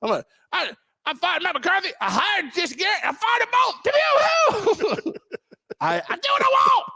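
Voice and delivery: high, shouted cartoon boss; mock owner rant. Owner bragging about firing coaches, high mock shout. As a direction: crow with giddy power.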